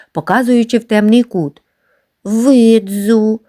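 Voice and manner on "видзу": put-on a touch of trembling child; small shaky reply, lightly voiced. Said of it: "сказав тремтячи Гриць".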